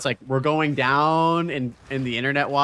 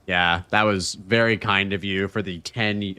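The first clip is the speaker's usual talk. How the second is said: ordinary talk, nothing marked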